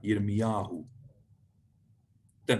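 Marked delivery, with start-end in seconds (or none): 0.0-0.8 "Yirmiyahu" carefully said yir-mi-YA-hu; penultimate stress. Shown as a demonstration. Teacher inviting a repeat, "Yirmiyahu" stressed on ya. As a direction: model "Yirmiyahu" for a learner to copy. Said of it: "have a go at pronouncing that".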